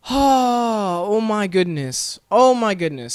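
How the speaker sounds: high, loud groan of exasperation; exasperated. Real, not acted. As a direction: wail it slowly in sheer exasperation. Exasperated reaction to story.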